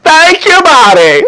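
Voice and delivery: high, loud mock thank-you; Miss Celie. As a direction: call it out high-pitched, imitating her. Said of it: "Miss Celie talking about some".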